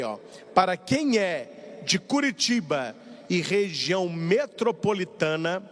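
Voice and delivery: ordinary talk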